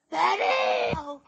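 high cracking yell of her name; desperate hoarse shout. Acted. Archie shrieking down the phone for Betty, voice cracking high. Calling Betty who cannot hear.

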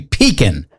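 pecan said PEE-kin, stress up front. Shown as a demonstration. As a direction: hit PEE in pecan and shrink the end to "kin". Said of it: "heard some people say".

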